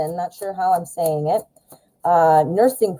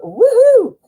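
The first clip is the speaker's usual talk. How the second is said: excited; 6 dB louder than usual. Real, not acted. Cheering about her new logo.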